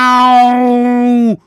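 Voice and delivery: a long, high, loud yowling miau; loud cat meow. Shown as a demonstration. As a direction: belt out a full cat's meow. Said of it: "So geht das".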